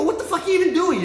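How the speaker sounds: high-pitched exasperated mock scold. Son scolding his dad, acted exasperation.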